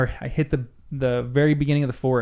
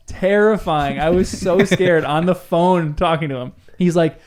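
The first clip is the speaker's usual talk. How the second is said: usual speaking voice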